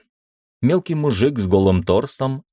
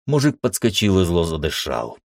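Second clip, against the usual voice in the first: ordinary delivery, nothing marked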